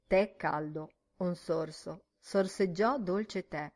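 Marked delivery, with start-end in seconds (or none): none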